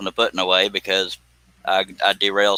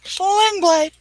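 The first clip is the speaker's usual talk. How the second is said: high squeak. Retold or imitated. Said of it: "Billy Bob Thornton".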